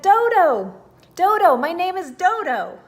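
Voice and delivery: loud, high fed-up yell; shouting name. A narrator's reading. The fed-up auk yells its name at the dodo, loud and high. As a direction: bellow the name at him.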